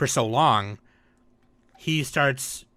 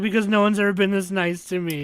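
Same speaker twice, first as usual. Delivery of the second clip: a touch higher, a hint of a teary blind girl; mock tearful Komugi; 5 semitones higher than usual. Friend retelling the anime, voicing Komugi moved to tears. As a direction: play her touched and near tears.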